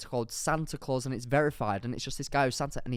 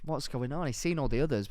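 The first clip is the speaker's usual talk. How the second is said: normal talking voice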